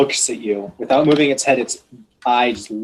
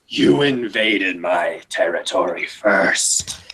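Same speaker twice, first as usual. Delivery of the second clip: low, slow, hoarsely whispered dragon voice; emphatic, drawn-out low creature voice; dragon's retort; 5 semitones lower, slower than usual. Acted. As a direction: rasp it slowly as an accusing dragon. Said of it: "what it yells back is".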